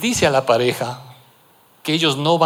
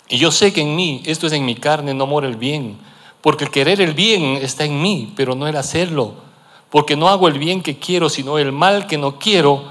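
raised, ringing scripture reading; preaching scripture; 3 semitones higher than usual. Acted. Preacher reading Romans aloud to the congregation, ringing and raised. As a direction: proclaim the passage with force.

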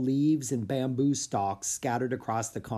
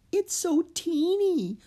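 put-on high, slow little-girl voice full of wonder; whispered; slower than usual. Annie to Jack at the incubator, high slow awe. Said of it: "whispered Annie".

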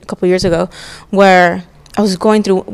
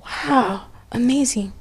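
awed whisper; 3 semitones higher, 8 dB quieter, slower than usual. Real, not acted. Awed by God's words.

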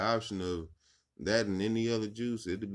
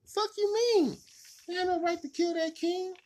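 imitating female God; 4 dB louder than usual. Female God smacking the officer, very high furious. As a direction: shriek it like a furious goddess. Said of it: "I think it's a female".

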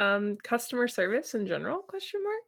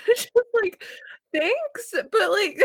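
laughing while talking; 11 semitones higher, 4 dB louder, slower than usual. Real, not acted. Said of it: "retelling bad meal, laughing".

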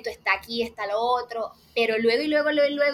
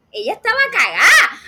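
loud, high, laughing out the line; laughing shout; 9 semitones higher, 9 dB louder than usual. Real, not acted. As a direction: burst out laughing as you say it. Laughing exclamation about scared Mary. (gritando entre risas)

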